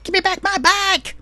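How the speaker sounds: very high-pitched comic shriek; mock angry scream. Imitating Misty after Ash takes her bike, mock scream. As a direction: screech it like a furious cartoon girl. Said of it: "her screaming at him".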